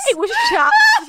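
high, slow laughing shout. Real, not acted. Telling friends about the birthday gift, laughing shout.

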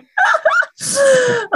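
loud, very high peal of laughter; shrieking, helpless laugh; real laughter. Real, not acted. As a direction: burst out laughing. Laughing at the pun.

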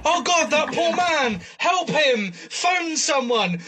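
mock-worried bystander, slow and high; concerned passer-by. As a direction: play a kindly tourist calling for help. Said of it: "They might be going".

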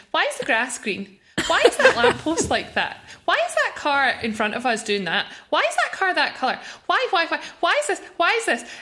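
lightly voiced child pestering from the back seat; chatty child. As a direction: rattle off questions like a nosy little kid. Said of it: "She's asking questions the whole time".

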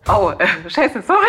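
laughing. Real, not acted. Caught being rude, laughs.

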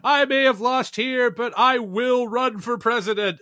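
high, lightly voiced defiant politician; a hint of a vowing candidate; mock Cheney. Cheney in her concession speech, lightly high and resolute. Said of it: "in her concession speech about just being like".

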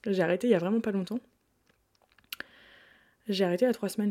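ordinary talk, nothing marked

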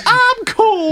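very high, loud mock granny; hammed-up cool old lady. He mimics the lady calling herself cool, high. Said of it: "I was sitting there going".

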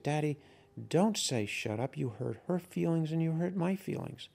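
a hint of a small daughter telling Daddy off; scolding little girl. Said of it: "put her hands on her hips and said".

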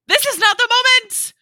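loud, high-pitched mock outburst; high, shouted protest; exasperated yell. Acted exasperated reaction to the scene.

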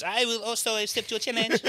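high, put-on French accent; hammed-up Frenchman voice. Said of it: "The Frenchman stands up".

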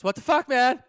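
high, very loud startled shout; alarmed shout, far above normal talk; panicked yell. Retold or imitated. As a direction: yell it like jolting a driver awake. Acting friend's panicked wake-up yell.

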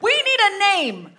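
high, loud, indignant rallying cry; rousing ringleader. Acted. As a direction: stir up the club against the press men. Improv as Jenny June.

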